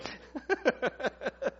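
chuckling at his own joke; amused run of short, high chuckles; real laugh. Real, not acted. Laughs at own joking prayer.

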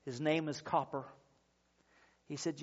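normal talking voice

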